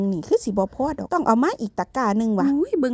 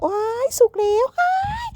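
delighted squeal; 13 semitones higher than usual. Real, not acted. Spotting ripe fruit on the way out, delighted squeal. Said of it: "ดีใจหันแล้ว".

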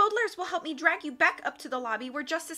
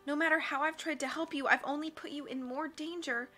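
tearful; 5 semitones higher, 3 dB quieter than usual. Acted. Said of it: "her eyes filled with tears".

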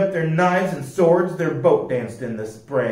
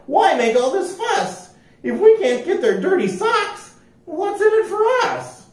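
very high, squeaky complaint; squeaky whiny pirate. A narrator's reading. A pirate whining to his crew, squeaky high. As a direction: whine about getting nothing.

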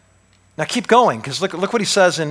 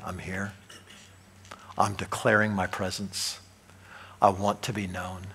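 hushed, low voice of God; God softly declaring his presence; 6 semitones lower, 8 dB quieter than usual. Pretend. Preacher voicing God, low and hushed. God's voice in the sermon.

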